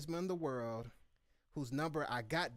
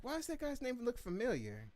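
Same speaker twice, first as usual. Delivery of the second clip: puzzled; 11 semitones higher than usual. Retold or imitated. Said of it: "I was like".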